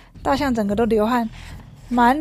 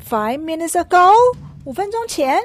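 much higher and louder; amazed elephant; 7 semitones higher, 4 dB louder than usual. Acted. Amazed elephant repeating Piggy's words, much higher and louder. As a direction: shriek the question in disbelief. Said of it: "大象就很惊讶啊".

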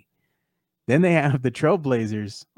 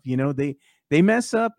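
ordinary delivery

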